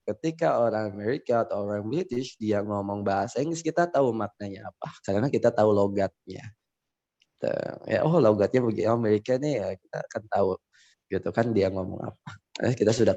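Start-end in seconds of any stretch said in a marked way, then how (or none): none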